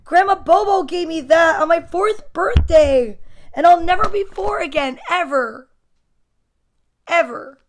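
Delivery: loud, high, melodramatic protest; dramatic kid. A narrator's reading. Boy defending birthday gift to mom, loud melodrama. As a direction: declaim it like a wronged child. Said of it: "Don't be so dramatic".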